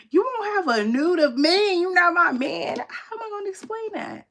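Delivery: rising outrage, voice climbing high; exasperated high-pitched protest; indignant outburst. Real, not acted. Indignant about the nude.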